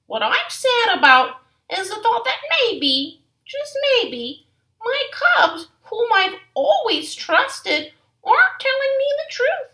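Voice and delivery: a mother hurt and pleading for honesty; sad Mama Bear. Acted. Mama speaks of trust.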